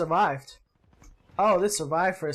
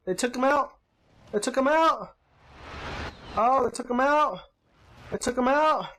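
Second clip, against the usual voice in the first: higher-pitched, repeating like a stuck recording; joking panic on a raised pitch; mock glitching freak-out; 5 semitones higher than usual. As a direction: pretend to glitch, looping each phrase alike. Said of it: "I'm glitching".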